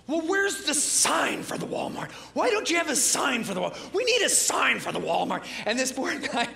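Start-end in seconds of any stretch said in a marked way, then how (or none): 0.0-5.4 grumpy complaining. Retold or imitated. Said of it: "And he goes".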